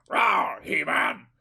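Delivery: high roaring cartoon beast; Battle Cat impression. Owner voicing his toy tiger greeting He-Man, Battle Cat impression. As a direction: growl it out loud, then rise into a playful high voice. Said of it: "That's my very good Battle Cat impression".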